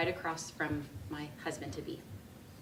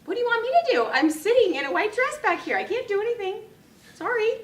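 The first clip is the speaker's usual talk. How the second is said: much higher, louder incredulous protest; exasperated helplessness; 9 dB louder than usual. Retold or imitated. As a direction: throw up your hands, nothing you can do. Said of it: "And my response".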